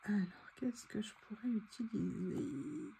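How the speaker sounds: very soft, half-whispered musing; muttering to herself. Real, not acted. Crafter searching her stickers for a word, muttering to herself. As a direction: think aloud under your breath.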